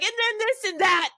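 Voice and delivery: mock-rant at the top of your lungs; mock screaming rant. Friend imitating her own passionate rants, mock screaming. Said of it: "screaming about the top of my lungs".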